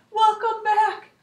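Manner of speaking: high, slow squeaky hamster shout; excited hamster yell. Acted. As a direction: call it from the top of the cage. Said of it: "climbed up ... and shouted".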